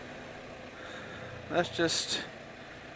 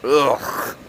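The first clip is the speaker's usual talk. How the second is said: bouncing over rough road; on a bumpy road; much slower than usual. Real, not acted. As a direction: voice jolted by bumps. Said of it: "bumpy there".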